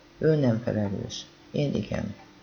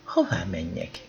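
lowered voice, a touch of alarm; frightened question; 6 semitones lower, 3 dB quieter than usual. A narrator's reading. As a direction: ask it low, mildly scared. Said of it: "kérdeztem ilyetten". (kissé ijedten, mélyebb hangon)

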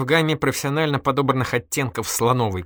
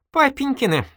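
very high, louder amused retort; laughing amusement; 5 dB louder than usual. A narrator's reading. Girl explains whose money she means, laughing amusement. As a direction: sing it out high, mocking and amused. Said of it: "рассмеялась девушка".

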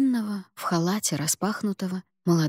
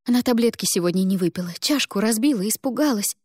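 rattling off; 2 semitones higher than usual. Acted. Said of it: "затараторила мама".

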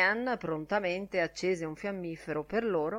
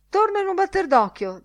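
much higher, louder parting call; calling out hurriedly; 13 semitones higher, 8 dB louder than usual. A narrator's reading. Bloom calling back as he hurries out, high and loud. Bloom calls out, leaving in haste.

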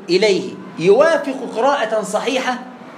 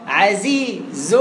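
drawn-out demonstration; much slower than usual. Shown as a demonstration. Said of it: "تجيب اللي بعدها أهو".

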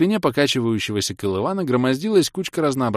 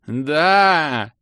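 a loud, higher yell of joy; shout; 7 semitones higher, 6 dB louder than usual. Acted. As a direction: yell it out eagerly. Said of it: "закричал Орбит".

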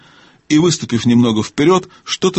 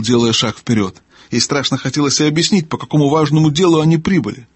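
plain reading voice

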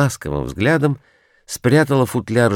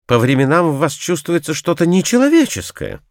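high-pitched, moderately raised cry; exclaiming; 8 semitones higher than usual. A narrator's reading. Narrator tells his friend he seems inhuman, exclaiming. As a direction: keep the reproach up high, not quite shouting. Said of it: "крикнул я".

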